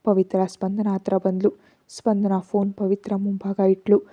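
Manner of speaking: usual speaking voice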